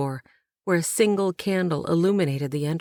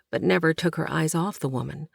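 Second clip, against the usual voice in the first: plain reading voice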